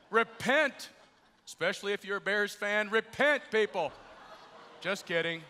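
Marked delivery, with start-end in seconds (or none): 0.1-0.9 mock preacher's call. Mimicking street preacher calling out list. 1.5-3.9 mock preacher's call. Joking street-preacher call to Bears fans.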